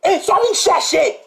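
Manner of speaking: outraged shouting; angry rant. Real, not acted. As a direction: hammer the questions at him. Continued rant.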